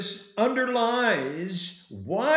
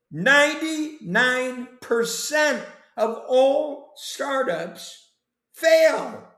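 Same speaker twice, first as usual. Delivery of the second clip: higher and higher, landing loud on "fail"; emphatic rising alarm; 8 semitones higher, 3 dB louder, slower than usual. Real, not acted. To Chad about failing startups, rising alarmed emphasis. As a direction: build up and hit "fail" hard and high. Dramatizing startup failure to the host.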